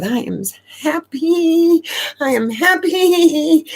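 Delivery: high, slow, crying through the words; crying happy tears. Retold or imitated. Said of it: "those are happy tears".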